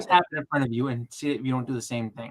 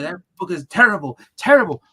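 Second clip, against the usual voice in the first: higher, louder scornful verdict; mock disgust; 5 semitones higher, 6 dB louder than usual. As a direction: trash the book with a sneer. Retold own blunt verdict.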